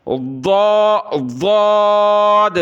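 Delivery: "واد" meant as dhad, thick and held long; tongue side on upper molars, sustain "واد"; tongue-edge articulation. Shown as a demonstration. Teacher demonstrates makhraj, "واد" thick and drawn out. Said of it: "زبان دائیں یا بائیں طرف لگائیں گے".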